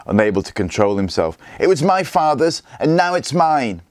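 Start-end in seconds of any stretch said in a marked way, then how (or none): 1.6-3.8 high, raised defiant cry; boy shouting his claim to the farm; yelling. A narrator's reading. Gunnar still yelling at Scully.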